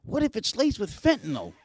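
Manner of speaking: mock-panicked, high-pitched wife; freaking-out wife. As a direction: imitate her fretting in a high voice. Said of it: "she's like, she's freaking out".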